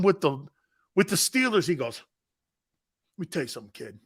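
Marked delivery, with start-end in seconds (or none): none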